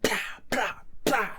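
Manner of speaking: quick mouthed gun sound; gunfire noises. Retold or imitated. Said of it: "just goes".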